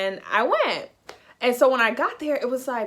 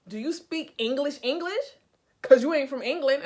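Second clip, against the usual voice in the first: offended; 4 semitones higher, 3 dB louder than usual. Retold or imitated. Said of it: "So he got offended".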